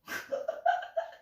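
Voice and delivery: breathy burst of laughter; laughing. Real, not acted. Storyteller cracking up at own story, laughing. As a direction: let out a fit of giggles. Breaks up while telling story.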